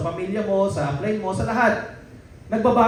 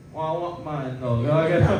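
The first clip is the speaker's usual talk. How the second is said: nasal mumble; 6 semitones lower, 4 dB quieter than usual. Retold or imitated. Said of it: "ngongo din".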